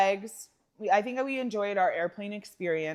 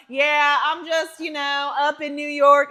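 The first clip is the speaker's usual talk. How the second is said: imitating Oklahoma accent; 5 semitones higher, 8 dB louder, slower than usual. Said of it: "still talking with this Oklahoma-ish accent".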